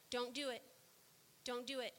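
very soft, low, almost whispered warning; hushed inner voice of God; still small voice. Acted. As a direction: murmur it like a voice in your ear. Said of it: "his still small voice whispering".

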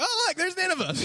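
very high singsong voice; comic high-pitched spotting of a city; mock delighted discovery. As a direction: pipe it up high, playfully. Jonah suddenly spots the city.

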